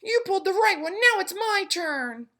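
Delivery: tiny, high, loud tempting voice; pigtail voice. A narrator's reading. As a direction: lure him like a little devil. Pigtail argues its turn.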